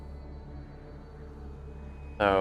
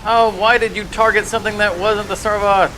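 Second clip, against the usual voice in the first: surprised; 7 semitones higher than usual. Real, not acted. Indignant surprise at opponent's play.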